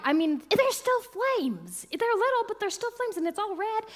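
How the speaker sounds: high and loud, thrilled; excited awe. Real, not acted. Describing the glowing flames, high excited awe. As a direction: build the thrill as the flames appear. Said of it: "so excited".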